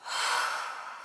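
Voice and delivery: slow audible exhale; deep breath together. Shown as a demonstration. Vlogger leads viewers in a shared breath, slow exhale. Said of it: "Let's do a deep breath together".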